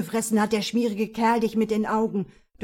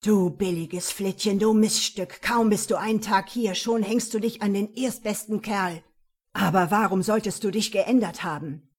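angry; 5 dB louder than usual. Acted. Jealous man attacks her.

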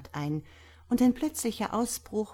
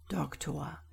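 soft, lower, gentle appeal; very friendly; 4 semitones lower, 8 dB quieter than usual. A narrator's reading. Priest pleading with impatient doctor, very friendly. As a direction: coax kindly, softly. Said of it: "bat der Geistliche sehr freundlich".

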